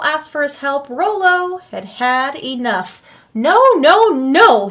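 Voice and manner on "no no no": loud, high, gleeful whoops of refusal; whooping no. A narrator's reading. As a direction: shout it out high and loud like a wild troll. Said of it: "he whooped".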